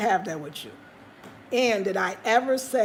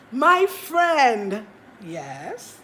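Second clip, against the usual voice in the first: auntie buttering up; 3 dB louder, much slower than usual. Retold or imitated. Imitating the auntie sweet-talking her before asking for money. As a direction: sweet-talk before the ask.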